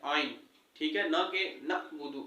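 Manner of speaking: ordinary delivery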